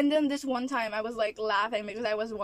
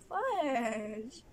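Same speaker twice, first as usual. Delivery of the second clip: softer, long drawn-out sighing mild curse; sighed frustration; 4 dB quieter than usual. Real, not acted. Vlogger realising half the critics are relatives, sighing in frustration. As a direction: let FUDGE out on a long, weary sigh.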